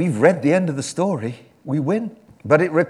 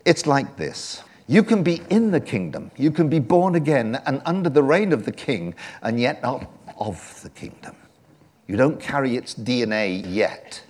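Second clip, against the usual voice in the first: ordinary talk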